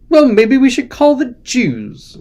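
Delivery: high, slow mock English accent. Shown as a demonstration. Joking to the stream in a put-on English accent. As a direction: throw a little Englishness into the joke. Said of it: "saying all my jokes with an accent".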